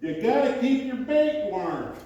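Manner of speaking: high, slow line breaking into laughter; punchline with a laugh. Retold or imitated. Chuck revealing his secret to the newbie, slow and laughing. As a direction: deliver it slowly, laughing through it. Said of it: "Chuck looks at him and goes".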